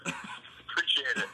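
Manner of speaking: slow breathy whispered thanks; whispering. Real, not acted. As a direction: murmur it slowly under your breath. Whispered thanks, then repeated.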